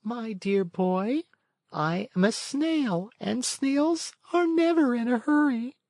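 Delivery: calm, peaceful snail. A narrator's reading. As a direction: gently refuse to rush. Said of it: "in a calm, peaceful voice".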